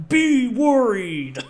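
loud, high, drawn-out menacing yell; hammed-up slow yell. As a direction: bellow it slowly as an acted villain's warning. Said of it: "P G just yells".

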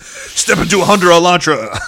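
high, loud ad announcer; joking about the getaway car, mock ad announcer. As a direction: sell the car like a commercial.

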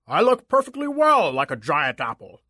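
put-on giant apple voice; offended monster's roar, held in check. A narrator's reading. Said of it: "the apple roared".

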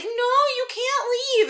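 high, slow, whining protest; whiny clingy plea. Retold or imitated. Bella begging Edward not to go, high whine. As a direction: pitch it way up like a clingy girlfriend begging. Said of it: "And Bella's like".

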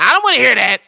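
high, loud, dismissive scolding. Retold or imitated. Cemetery worker brushes off the spirits, mock stern woman. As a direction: shut it down like a no-nonsense woman.

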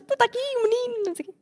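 high-pitched comic fluster; mock-agitated high squeal of words. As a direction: play it flustered, voice way up. Flustered reply to colleagues.

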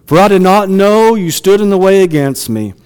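loud, ringing scripture read to the congregation. Acted. Preacher reading Balaam's story aloud, loud and declaiming. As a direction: proclaim the passage with full force.

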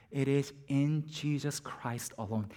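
usual speaking voice

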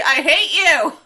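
high, loud, hammed-up outburst; mock fury. Hot Rod's line repeated for laughs, mock fury. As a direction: blurt it like a petulant cartoon hero.

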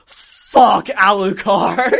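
loud, drawn-out, high yell; hammed-up roar cracking into laughter; all caps. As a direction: shout it slowly as if every letter is capitalised. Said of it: "and then in all caps".

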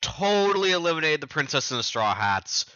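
acted-out false assurance; bluffing. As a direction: bluff it breezily, as if the job is done. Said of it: "He's bluffing to Mister Zero".

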